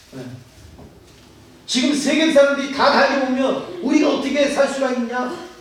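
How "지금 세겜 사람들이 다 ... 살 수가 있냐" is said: higher, worried rebuke of the sons; a retold father's fearful scolding, mildly heated. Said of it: "막 아들을 야단을 쳤는데". (겁에 질려 아들들을 다그치는 아버지)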